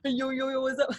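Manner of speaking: playful, laughing "yo" run; lightly put-on greeting, kept resonant; casual hype greeting. Shown as a demonstration. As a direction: call out a mock-cool hello. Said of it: "I'm still doing all of that".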